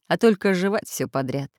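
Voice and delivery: plain reading voice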